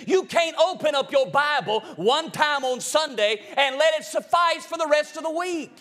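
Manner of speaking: loud, high preacher's call; preaching at full force. Acted. Preaching against seducing spirits.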